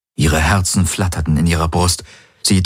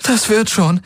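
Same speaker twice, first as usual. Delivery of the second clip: holding back a sob. Acted. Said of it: "unterdrückte ein Schluchzen".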